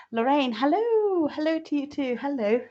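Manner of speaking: high, sing-out hello, stretched long; delighted greeting. Real, not acted. Host spotting Lorraine among viewers, delighted greeting.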